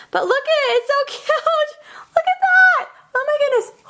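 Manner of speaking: very high, loud gushing squeal; excited squealing. Real, not acted. Crafter squealing to viewers over her butterfly card. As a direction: squeal with delight at something adorable.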